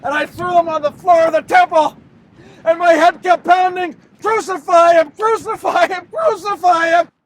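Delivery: high, loud, driven cries; frantic outburst. Acted. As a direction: hammer it out like pounding in the head. Judas frantic, crowd pounding in head.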